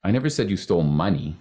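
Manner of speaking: "money" stressed at the end. Shown as a demonstration. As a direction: save the weight for the word "money". Last emphasis in the game.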